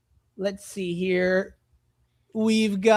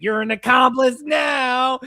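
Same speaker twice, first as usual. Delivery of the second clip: mock puppet shout; 5 semitones higher, 3 dB louder, slower than usual. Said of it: "And he's like".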